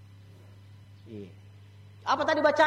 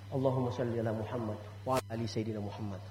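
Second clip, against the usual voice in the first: prayer recitation; 12 semitones lower, 8 dB quieter than usual. Shown as a demonstration. (bacaan salawat pelan dan khidmat)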